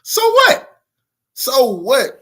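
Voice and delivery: loud, high burst of outrage; indignant outburst. Real, not acted. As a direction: throw it back at them, loud and high. Indignant retort to the quoted moderator.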